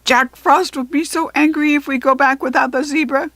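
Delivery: high, loud fretful goblin; goblin cry. A narrator's reading. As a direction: cry out in worry. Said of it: "cried the back goblin".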